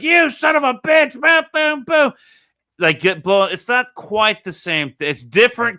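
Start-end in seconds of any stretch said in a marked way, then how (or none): 0.0-2.1 high, loud, mock-furious boy; angry kid. Robin hitting Batman in the car, a high angry yell. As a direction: yell it like a kid throwing punches. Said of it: "Robin being like".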